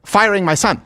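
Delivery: lightly voiced clipped, articulate father; a hint of the doctor's staccato delivery; mock-formal thanks from the intern's father; staccato imitation. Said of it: "he talks like this".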